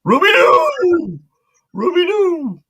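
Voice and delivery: loud cartoon Great Dane calling his name; Scooby-Doo voice. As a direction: bark out your own name like Scooby. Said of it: "it's Scooby Dooby Doo".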